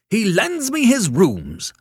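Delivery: high, loud, drawn-out wail. A narrator's reading. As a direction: wail the grievance slowly down the phone. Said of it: "wailed Mister Morrison".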